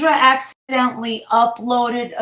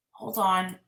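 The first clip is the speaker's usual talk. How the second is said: usual speaking voice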